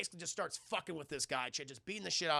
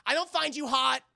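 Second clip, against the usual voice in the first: loud, raised protest; defensive denial; 7 semitones higher, 11 dB louder than usual. Retold or imitated. Woman to flirting agent, loud defensive denial. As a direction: deny it too forcefully.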